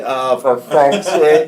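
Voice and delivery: loud, slow drawn-out exasperation; exasperated groan. Retold or imitated. Son watching his dad start a fight, exasperated groan. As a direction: drag it out, fed up. Dad gives Mickey the finger.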